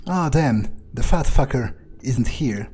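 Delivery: ordinary delivery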